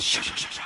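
rapid unvoiced shh sounds; hushed sibilant chatter; imp's hissy whispering. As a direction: imitate a sneaky whisperer with shushes. Imp's whispering noise imitated.

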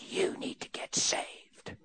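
slow, voiceless whisper; drawn-out hushed admonishment; whispered retort. Retold or imitated. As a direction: imitate a man whispering a stern demand. Man hisses back in whispered exchange.

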